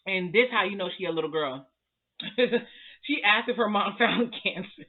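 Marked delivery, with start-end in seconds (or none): 2.4-4.9 laughing through the words; cracking up mid-sentence; giggly real laughter. Real, not acted. Laughing at a silly question.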